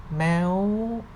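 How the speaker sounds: "meow" high and climbing. Shown as a demonstration. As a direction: lift "meow" steadily upward. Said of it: "represent the high sound".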